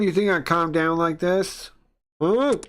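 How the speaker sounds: high, loud, indignant challenge. Acted. Angry man made madder by a calm voice, loudly. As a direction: build to a sharp, high final jab. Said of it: "He got louder".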